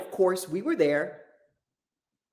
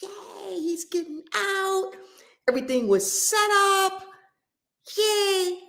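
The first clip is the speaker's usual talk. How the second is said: high, thrilled cry of joy; excited cheer; 12 semitones higher than usual. Retold or imitated. Retelling the moment a friend's release was set, overjoyed. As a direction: cheer like the release is finally happening.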